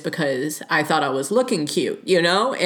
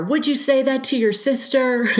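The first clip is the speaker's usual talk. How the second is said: pitched much higher, a sharp retort thrown back; mock yell back; 9 semitones higher than usual. Talking back to a catcaller on the street, in a higher mock retort. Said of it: "I would yell back something".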